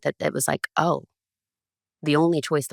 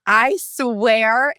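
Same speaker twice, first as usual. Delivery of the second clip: high, breathless I swear; stunned disbelief; 7 semitones higher than usual. Retold or imitated. Hearing the password spoken, stunned high reaction. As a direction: gasp it out in disbelief.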